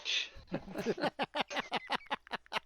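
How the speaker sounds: laugh. Real, not acted. Laughing at cowboy voice.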